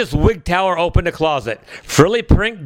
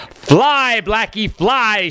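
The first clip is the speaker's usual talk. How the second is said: urgent call; 4 semitones higher, slower than usual. Acted. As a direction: urge the pony on, calling out. Princess urging pony to fly.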